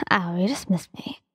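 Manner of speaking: whispered mock pout, teasing; sulky fake-hurt whisper. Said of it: "He fake pouted and teased you".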